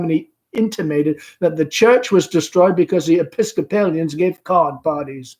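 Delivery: mother sending the caller to her daughter; Amanda. Acted. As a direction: chatter on brightly. Amanda's long speech.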